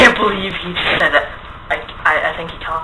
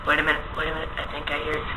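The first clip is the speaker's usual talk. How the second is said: much softer, listening in fear; hushed; 10 dB quieter, much faster than usual. Acted. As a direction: hush it, straining to hear. Listening for the ghost.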